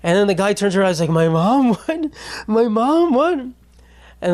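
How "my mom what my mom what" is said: high, wailing mock distress; mock hurt player. Player turns round to a heckler about his mom, mock upset. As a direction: imitate a player wounded and disbelieving, pitched way up.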